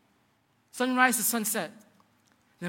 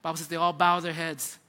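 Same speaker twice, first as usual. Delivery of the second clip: normal talking voice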